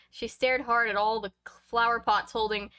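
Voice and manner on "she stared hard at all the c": plain reading voice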